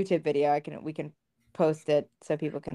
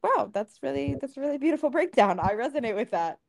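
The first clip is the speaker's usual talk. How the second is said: high, gushing mock admiration, pitched way up; mock impressed; 8 semitones higher than usual. Speaker to podcast host, retelling her reply to the boyfriend, mock gushing high. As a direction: play at being wowed by his breakdown, higher. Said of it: "And I'm like".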